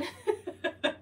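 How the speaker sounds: high laugh. Real, not acted. Laughing at sister's reaction.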